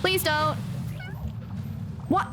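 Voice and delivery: normal talking voice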